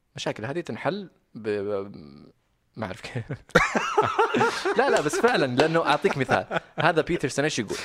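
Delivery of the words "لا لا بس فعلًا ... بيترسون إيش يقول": talking through laughter; giggling while speaking; laughing. Real, not acted. Laughing after admitting not knowing.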